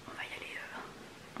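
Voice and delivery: slow hushed whisper. Real, not acted. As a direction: whisper it to yourself, slowly. Muttering to herself while applying.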